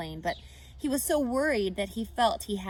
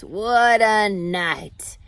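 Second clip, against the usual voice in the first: slow, louder husky sigh of a stuffed rat; 13 dB louder, much slower than usual. A narrator's reading. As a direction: drag it out, worn out and full. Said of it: "husky voice".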